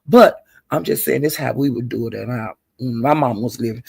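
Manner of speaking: usual speaking voice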